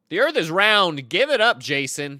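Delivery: loud, high teasing call-out; shouting at a friend. Real, not acted. Streamer calling out his friend Jason, loud teasing shout. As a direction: yell it across the room at Jason.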